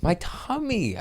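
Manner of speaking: put-on high little-kid whine on "tummy"; childish whine. Grown man whining about his tummy like a little kid. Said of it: "a grown-ass man saying my tummy".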